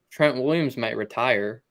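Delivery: ordinary talk, nothing marked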